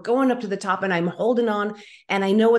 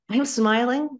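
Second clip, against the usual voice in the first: ordinary delivery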